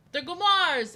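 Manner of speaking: loud, high Gomars; emphatic declaration. Acted. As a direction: proclaim it.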